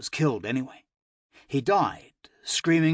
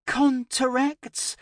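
put-on high, stumbling child voice; puzzled little girl; 12 semitones higher than usual. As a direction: echo the word like a confused child. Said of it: "fumbling over its newness".

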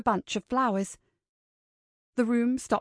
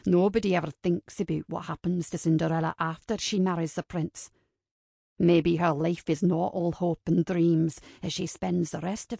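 low male character voice, cynical; cynical man; 4 semitones lower than usual. Acted. Kincaid debunks Ava's fairy tale, low cynical voice. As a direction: argue low and measured, as a realist.

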